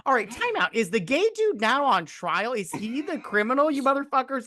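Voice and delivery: high-pitched, incredulous challenge; indignant disbelief. Retold or imitated. Viewer challenging the courtroom over the man on trial, indignant disbelief.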